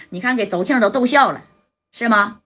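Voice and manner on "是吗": usual speaking voice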